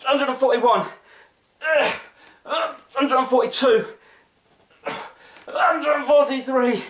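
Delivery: high, slow, strained counting; straining effort. Real, not acted. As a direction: squeeze out each count. Straining through last sit-ups.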